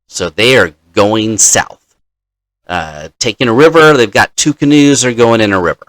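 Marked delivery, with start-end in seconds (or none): none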